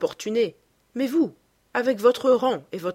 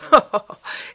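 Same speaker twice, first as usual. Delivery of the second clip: loud unvoiced burst of laughter; laughing aloud; 3 semitones higher, 8 dB louder than usual. A narrator's reading. Lord Henry laughs at Basil's secret, loud breathy laugh. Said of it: "Lord Henry se mit à rire".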